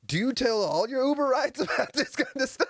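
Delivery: high-pitched, laughing incredulity; incredulous, breaking into laughter; amused disbelief. Acted. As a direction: ask it through a laugh. Incredulous question, laughing through it.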